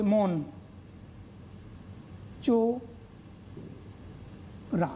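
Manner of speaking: "মন চোরা" with চোরা stretched out very slowly and breathily; drawn-out syllable demo. Shown as a demonstration. Teacher to students, demonstrating the split in "মন চোরা" with a slow whispered চোরা. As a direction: say "মন" short, then draw out "চোরা" in a soft whisper. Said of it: "তুমি নিজেই উচ্চারণ করলে পারবে".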